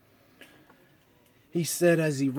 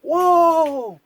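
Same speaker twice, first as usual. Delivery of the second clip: high, loud, amazed whoa; surprised whoa; 11 semitones higher, 6 dB louder than usual. Acted. Mickey surprised as the sled flies. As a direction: cry out as the sled lifts.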